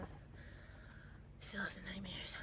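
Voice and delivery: breathless whisper; whispered confession. Real, not acted. Admitting her lasting trauma to the viewers in a whisper. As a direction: whisper it like a haunted memory. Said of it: "I still have trauma".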